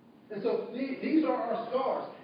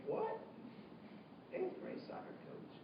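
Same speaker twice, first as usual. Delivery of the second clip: breathless, whispered disbelief, much softer; stunned whisper; 12 semitones higher, 14 dB quieter than usual. Retold or imitated. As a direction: whisper it as if you cannot believe it. Student stunned by the answer.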